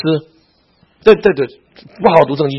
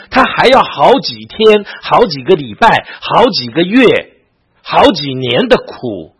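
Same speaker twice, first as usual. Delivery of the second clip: slow, louder, each time word stretched; drawn-out emphasis; 2 semitones higher, 4 dB louder, slower than usual. Real, not acted. Pastor urging the church to feel the grief, slow stressed build. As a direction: lean on 好几天 好几个礼拜 好几个月 好几年, slowly and louder.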